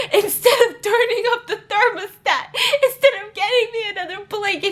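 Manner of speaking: high, giggling through the words; can barely get it out laughing. Real, not acted. Laughing while telling.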